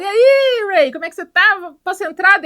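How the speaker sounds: high, loud, eager hello at the door; cheery greeting. Retold or imitated. Ed calling through the door to his brother Ray, loud and eager. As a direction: burst in calling out to your brother.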